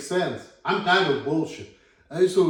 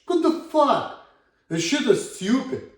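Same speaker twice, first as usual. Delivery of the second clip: exasperated; 7 semitones higher than usual. Real, not acted. Ranting, exasperated outburst.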